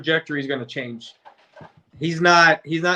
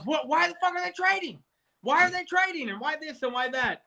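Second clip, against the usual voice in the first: much higher, squeaky mock-outraged voice; imitating angry friend; 11 semitones higher, 4 dB quieter than usual. Mocking a friend ranting about the Eagles trade, in a squeaky mock-outraged voice. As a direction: ham up a friend losing it over the trade. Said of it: "And he got all pissed off".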